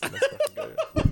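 excited laughing cheer. Real, not acted.

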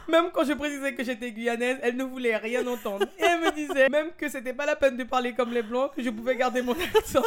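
high voice on the edge of laughing; amused, squeaky and about to crack up; holding back laughter. Real, not acted. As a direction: read on while fighting the giggles. Reading story, struggling not to laugh.

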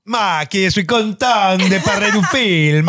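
slow, hammed-up Italian accent; comic Italian-American accent; mock Italian accent. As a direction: lay on a fake Italian accent like an American actor. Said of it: "qui sont avec leur accent".

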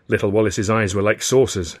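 ordinary delivery, nothing marked

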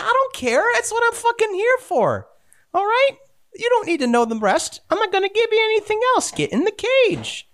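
high, mocking villain voice; a villainess laughing at her betrayed ally, pitched high; gloating villainess. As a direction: sneer at the prisoner and cackle. GM voices villainess ordering prisoners.

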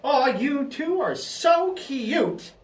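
put-on high, slow, gushing kid voice; little bellhop boy. Bellhop admiring the old couple, high drawn-out boy voice. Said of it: "we see a little boy".

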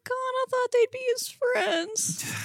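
high-pitched, dismayed outburst; mock dismay. Real, not acted. Co-host hearing rats ate the man, high mock dismay. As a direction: react in a high voice, as if let down by the rats.

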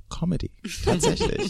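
high laughing punchline; laughing reveal. Real, not acted. Former lawyer reveals his comedy career to hosts, laughing.

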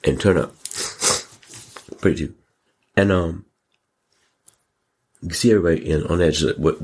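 ordinary delivery

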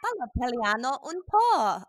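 playful Italian phrase with a laugh; speaking Italian. Shown as a demonstration. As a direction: show off a little school Italian. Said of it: "I spoke Italian at school".

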